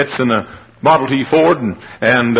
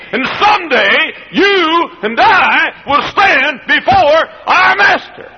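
preaching at full force; 8 semitones higher than usual. Acted. Preacher warning the congregation, rising to a shout. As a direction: hammer it home like a revival preacher.

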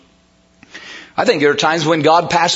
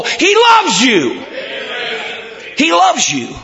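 impassioned preacher, pitch climbing; fervent preaching; 6 semitones higher than usual. Acted. Preacher to congregation on God's love, fervent and building. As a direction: preach it with mounting fervour.

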